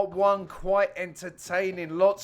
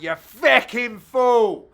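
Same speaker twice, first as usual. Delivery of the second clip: hammed-up furious rant, louder; imagined inner rage at a partner, a hammed-up louder rant; mock angry South African rant; 3 semitones higher, 7 dB louder than usual. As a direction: spit it out like a mock-furious teammate. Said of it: "he would have been like".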